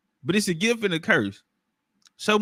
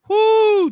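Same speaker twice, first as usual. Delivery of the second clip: high, loud whoop; excited whoo; 8 dB louder than usual. Real, not acted. Whooping at his own joke, loud high whoo. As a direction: let out a delighted whoo.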